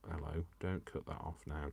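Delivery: ordinary talk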